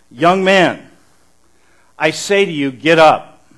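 loud, commanding call to the dead; commanding shout. Acted. Preacher voicing Jesus raising the dead, commanding shout. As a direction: boom it out like a command to rise.

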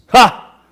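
single loud, high scornful "ha"; mocking laugh. Crowd laughing at Jesus on the cross, mocking laugh. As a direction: fire off one derisive laugh. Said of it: "Laughing at him".

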